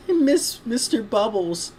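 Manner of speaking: high, slow, plaintive filly; badly faked little-girl falsetto. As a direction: whine it like a small child. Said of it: "I can't do a good little girl voice".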